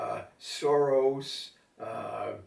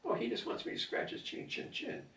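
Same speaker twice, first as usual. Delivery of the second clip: whispered, softer cooing; soft aside; 9 semitones higher, 10 dB quieter than usual. Real, not acted. Owner whispering while scratching his cat's chin.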